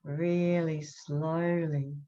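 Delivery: slow, drawn-out demo of slow talking; deliberately slowed-down words; really slowly. Shown as a demonstration. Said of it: "I've got to get used to you talking".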